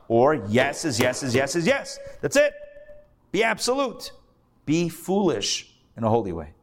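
normal talking voice

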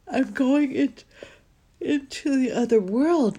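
high and loud, stretched by a yawn. Real, not acted. Podcaster describing drifting off, voice caught in a yawn. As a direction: talk through a big yawn. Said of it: "I can't believe I'm still yawning".

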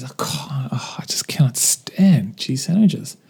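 whispered mock despair. Worker whispering his despair over cheese sandwiches again. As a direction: breathe it out, defeated. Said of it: "And he says".

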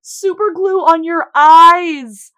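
loud, slow, scandalised cry; horrified shout at a TV twist; shocked exclamation. Real, not acted. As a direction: yell it out in disbelief.

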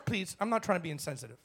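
ordinary talk

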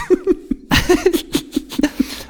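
high breathy laugh. Real, not acted. Laughs after crowd chant.

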